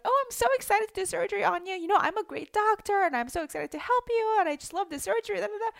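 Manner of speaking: high, loud, fast gushing doctor; overexcited doctor. Pretend. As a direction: gush like a giddy surgeon. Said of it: "a doctor who's like".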